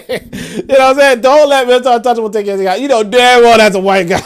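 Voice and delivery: high, loud and cracking up; laughing exclamation. Real, not acted. Host ribbing a commenter over an obvious answer, laughing exclamation. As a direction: call it out loudly through real laughter.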